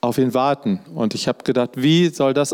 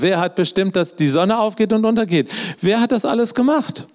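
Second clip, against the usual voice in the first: God booming rising questions at Job; God questioning Job, building; 7 semitones higher than usual. Retold or imitated. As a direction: pile the questions on Job, climbing. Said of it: "Also Hiob".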